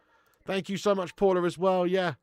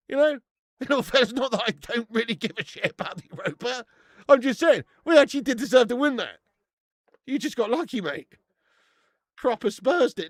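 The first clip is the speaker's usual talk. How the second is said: normal talking voice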